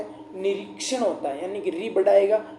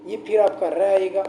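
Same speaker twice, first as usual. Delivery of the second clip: ordinary talk, nothing marked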